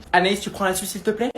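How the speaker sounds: ordinary delivery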